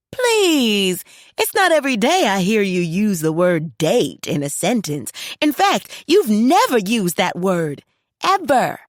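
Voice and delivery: high, loud mock-indignant daughter; teasing daughter.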